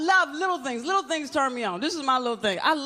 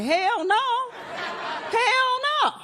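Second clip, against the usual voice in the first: emphatic refusal; 9 semitones higher, much slower than usual. Retold or imitated. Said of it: "And I was like".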